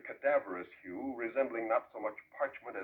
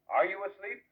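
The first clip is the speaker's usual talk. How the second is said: raised, higher call across the bed; urgent raised call to the dying man; calling out loud; 9 semitones higher, 6 dB louder than usual. Acted. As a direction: call his name to wake him. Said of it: "I said".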